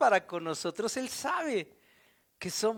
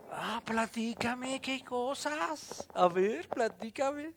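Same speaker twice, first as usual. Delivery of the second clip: higher, slow and soft, inviting them to talk; gentle, curious Jesus; 4 semitones higher, 3 dB quieter, slower than usual. Acted. Jesus on the road, gently asking the disciples to tell him. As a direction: coax it out of them, playing innocent.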